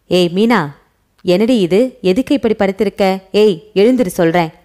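usual speaking voice

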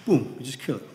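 usual speaking voice

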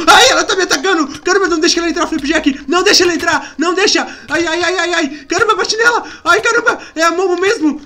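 loud, urgent cries for help; panicked. Acted. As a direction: yell to your companion while under attack. Acted attack by Momo, calls for help. (em pânico, gritando por socorro)

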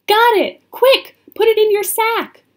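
high, loud, hurried calls; urgent exclamations. A narrator's reading. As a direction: rush him along excitedly. Annie hurries Jack amid danger.

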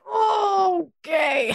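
high, drawn-out uneasy okay; mock overwhelmed. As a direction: stretch it out high, daunted by the pile. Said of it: "what does feel a little overwhelming".

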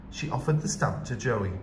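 plain reading voice

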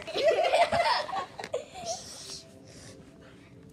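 high-pitched giggling burst; real giggle, high and long; high laughter. Real, not acted. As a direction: break into a high, squealing laugh. Laughs after scene card.

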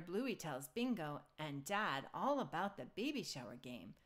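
ordinary delivery

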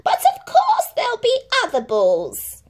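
very high, mock surprise; sly stepmother. A narrator's reading. Stepmother mocks Cinderella, high sly voice. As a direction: feign concern sweetly. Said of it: "sneered the stepmother".

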